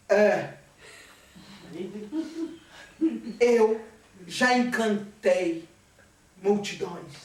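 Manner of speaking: a lightly voiced vain spirit of the footlights; vain stage spirit. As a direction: boast like a faded stage star. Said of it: "Aquela voz".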